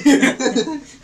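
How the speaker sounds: high, loud burst of laughter; a long, high, loud laugh at the story; hearty laugh. Real, not acted. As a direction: crack up loudly. Laughing at a flirty customer story.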